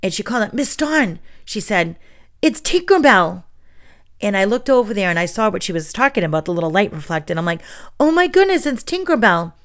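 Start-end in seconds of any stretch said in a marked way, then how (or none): 0.5-1.2 put-on excited child. Child calling teacher excitedly. 2.4-3.4 put-on excited child. Child spotting a fairy. 8.0-9.5 high, delighted exclamation; excited gasp. Retold or imitated. Teacher playing along for her class, high and delighted.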